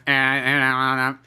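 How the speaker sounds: imitated croaking noises; smoker's throat-hole voice. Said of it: "you know, talks like that".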